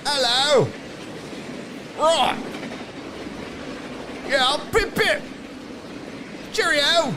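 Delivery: high, hammed-up British calls turning Australian; botched British accent. Streamer greeting a London viewer, botched British accent. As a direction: attempt British catchphrases in a bad accent. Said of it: "Every time I try to do a British accent".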